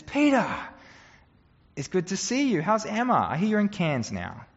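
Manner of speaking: put-on a bishop beaming at an old acquaintance; delighted greeting. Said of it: "And he says".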